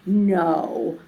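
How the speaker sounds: normal talking voice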